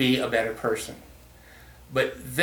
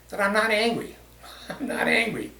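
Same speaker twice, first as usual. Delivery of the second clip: laughing, higher, insisting he's not angry; 8 semitones higher than usual. Real, not acted. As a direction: chuckle through the denial. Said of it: "My tone, my inflection, and my volume".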